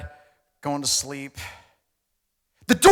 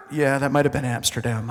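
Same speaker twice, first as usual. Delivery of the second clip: ordinary talk, nothing marked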